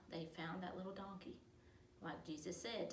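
ordinary delivery, nothing marked

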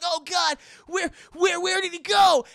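high, loud, stammering search call; panicked. Retold or imitated. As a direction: shout frantically for a lost friend. Friends searching frantically.

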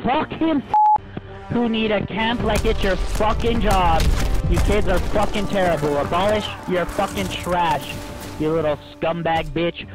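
screaming furious gamer rage; berserk rage. Real, not acted. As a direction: scream insults at full volume, seething. Raging gamer screaming at opponents.

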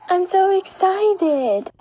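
slow, high-pitched happy gush; excited. Acted. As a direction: drag it out with delight. Girl repeats her joy.

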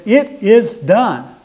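high, loud and very slow declaration; loud proclamation. A narrator's reading. Preacher voices Jesus declaring the end, loud and slow. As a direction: proclaim it drawn out like a final verdict. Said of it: "with a loud voice said".

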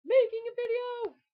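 high, loud outburst; exasperated exclamation. Real, not acted. Vlogger on yet another take of the video, loud exasperated cry. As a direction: burst out in loud frustration.

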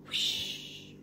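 a soft, breathy whispered whoosh; hushed storyteller whoosh. Pretend. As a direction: whisper the rocket sound away. Whispered rocket sound.

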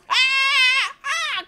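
high, loud screams; alarmed screams. Real, not acted. Player hit in game, alarmed screams. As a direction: cry out twice in shock.

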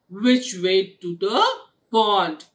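loud, abrupt rude question; rude demand. A narrator's reading. Blackie asks Auntie Goat the way, rude demand. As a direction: demand it bluntly and loudly. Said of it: "She just very rudely".

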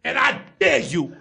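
indignant sports pundit, emphatic pauses; Stephen A. Smith. Acted. Sports pundit blaming the front office, indignant impression. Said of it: "another question for Stephen A".

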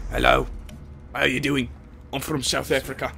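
badly faked South African accent; terrible South African accent. Player introducing themselves, in a bad mock South African accent. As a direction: attempt a hammy South African greeting. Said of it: "It's a terrible South African accent".

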